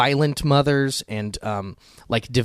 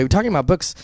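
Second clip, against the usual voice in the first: normal talking voice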